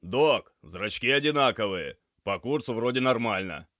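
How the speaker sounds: loud report. A narrator's reading. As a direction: report urgently over the noise. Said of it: "он громко произнёс".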